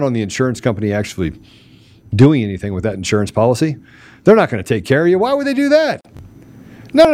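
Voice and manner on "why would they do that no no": high, mocking falsetto question; mock them with a falsetto squeak; sarcastic high squeak. Real, not acted.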